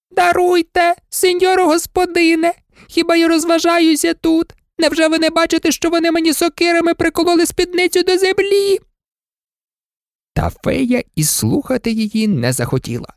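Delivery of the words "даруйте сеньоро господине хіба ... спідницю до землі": much higher, aggrieved maid voice; protesting old maid. Acted. Maid protests to her mistress, high. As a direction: plead innocence, high.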